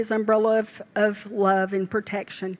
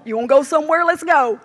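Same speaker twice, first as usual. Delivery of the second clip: higher, louder eager invitation; eager nanny; 5 semitones higher, 6 dB louder, faster than usual. Retold or imitated. Nanny offering the grandbaby any outing, excited and loud. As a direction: sweep the grandbaby off, bright and loud.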